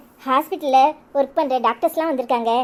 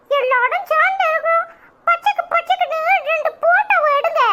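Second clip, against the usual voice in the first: put-on much higher, chirpy little kid calling out; child voice; 11 semitones higher than usual. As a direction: play an eager small child posing for photos. Child calls the photographer. (உற்சாகமான சின்னப் பிள்ளைக் குரல்)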